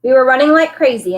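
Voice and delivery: ordinary delivery, nothing marked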